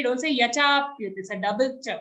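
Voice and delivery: usual speaking voice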